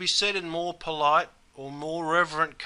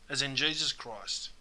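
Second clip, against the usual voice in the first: ordinary talk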